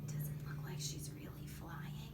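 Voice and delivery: hushed doubtful aside; whisper. Acted. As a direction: confide it in a whisper.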